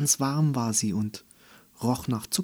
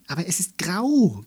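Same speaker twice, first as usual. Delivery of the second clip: higher, louder anxious gasp; 7 semitones higher, 4 dB louder than usual. Acted. Ellie frightened of the grey thing, gasping. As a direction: blurt it out in a fearful gasp. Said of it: "keuchte Ellie ängstlich".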